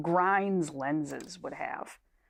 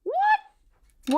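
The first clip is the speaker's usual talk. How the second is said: surprised squeak; 4 dB louder than usual. Real, not acted. Sudden squeak.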